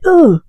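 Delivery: high, loud mock-disgusted "ugh". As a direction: recoil with a short grossed-out "ugh". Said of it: "he's like".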